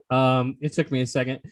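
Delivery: usual speaking voice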